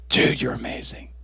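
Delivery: soft, whispery amazement; hushed awe. Retold or imitated. Worshipper sitting under the anointing, hushed awe. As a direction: breathe it out in wonder.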